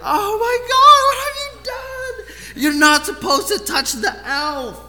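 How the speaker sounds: very high, loud mock-horrified child; imitated distraught son. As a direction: shriek it like an appalled little boy, hammed up. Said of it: "my son's like".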